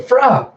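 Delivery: high, loud creature squawk; sudden high yelp; imitated creature cry. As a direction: cry out like a small alien creature.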